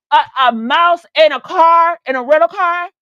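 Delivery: loud, slow, high disbelief; appalled disbelief. Retold or imitated. Customer hearing of a mouse in the rental, appalled. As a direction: punch out each word, scandalized.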